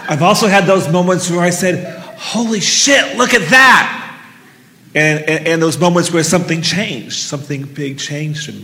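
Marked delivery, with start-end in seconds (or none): 2.2-4.0 raised, drawn-out holy shit; stunned, punchy outburst; amazed exclamation. Retold or imitated. Amazed exclamation in retold moment.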